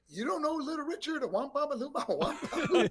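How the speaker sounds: hammed-up Dusty, laughing through the [name] bit; playful high Dusty voice; imitating Dusty.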